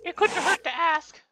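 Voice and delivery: high squeaky elf voice; squeaky little elf helper. Acted.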